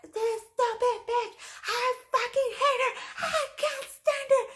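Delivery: very high-pitched imitated rage; hammed-up squealing fury; mocking screechy tantrum. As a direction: imitate her hysterical hateful outburst way up high. Mocking coworker's hateful tirade.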